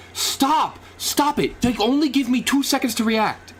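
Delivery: high, indignant outcry; exasperated protest. Real, not acted. Protests at too little time.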